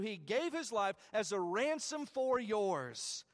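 fervent preacher calling out to the congregation; preaching at full force. Acted.

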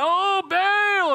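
very high, long-held call; crying out. Acted. Worshippers marching around the altar, high drawn-out cry. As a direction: wail out the god's name. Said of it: "and they're crying".

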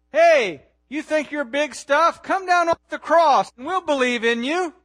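loud, high crowd taunt; jeering shout. Retold or imitated. Crowd mocking Jesus on the cross, jeering shout. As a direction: shout it like a heckler daring him.

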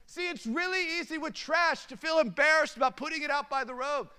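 high-pitched, driving sermon delivery; impassioned preaching. Real, not acted. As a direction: press the point home to the congregation. Preaches at raised pitch to congregation.

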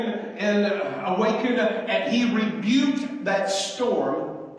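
loud sermon push; preaching at full force. Acted. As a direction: drive it home to the congregation.

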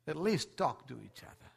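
ordinary delivery

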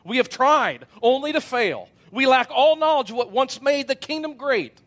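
frustrated outburst. Acted. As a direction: raise the voice in frustration.

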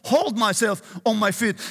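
ordinary talk, nothing marked

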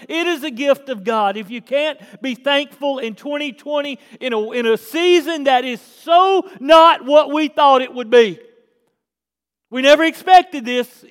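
loud, rising preacher's build; preaching at full force. Acted. Pastor preaching to his church, full force. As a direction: drive it home to the church.

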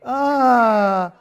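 high, loud pained wail; imitating a hurt player crying out; mock cry of pain.

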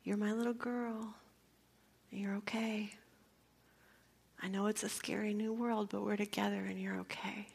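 soft comforting whisper; tender reassurance. Retold or imitated. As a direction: soothe the newborn. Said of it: "So I said".

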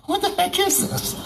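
high, startled disbelief; bewildered Israelites staring at strange food, asking in shock; baffled what-the-heck. Retold or imitated. Israelites puzzled by manna, high startled question. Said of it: "it was like".

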